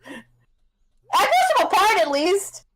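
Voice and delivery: high-pitched furious yelling, rising to a cry; high furious shout. Acted. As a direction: yell like a tiny enraged fighter. Dwarf voiced screaming at Krobus.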